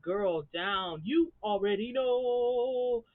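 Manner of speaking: high, loud, drawn-out hype; hammed-up cheer, slow and loud; mock hype. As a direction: stretch it out like hyping up a friend. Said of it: "I'd be like".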